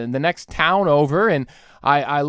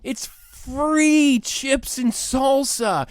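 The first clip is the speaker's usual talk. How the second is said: mock waiter; 8 semitones higher, slower than usual. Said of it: "they say".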